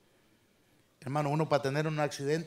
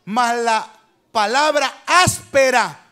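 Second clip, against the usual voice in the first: louder and slower, pushing out palabra áspera; harsh word punched; 4 semitones higher, 12 dB louder, slower than usual. Shown as a demonstration. Preacher acts out the harsh word, loud and slow. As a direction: hit it hard and drawn out.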